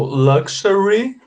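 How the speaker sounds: "luxury" and "huge" said very slowly and clearly; slow model words. Shown as a demonstration. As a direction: spell out both words slowly for the student. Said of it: "correct two words here". (leitura bem lenta de "luxury" e "huge")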